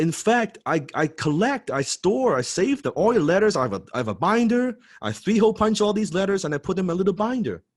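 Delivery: high, breathless-with-enthusiasm retold gushing; raving fan. Fan confessing his letter collection to idol, excited raving. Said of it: "this guy's raving about his letters".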